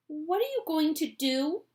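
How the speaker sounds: alarmed question; cried. Acted. Charlie asks Wonka over the roof, alarmed. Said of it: "cried Charlie".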